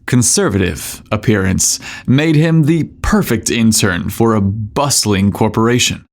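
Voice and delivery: ordinary delivery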